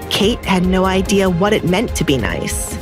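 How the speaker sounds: ordinary delivery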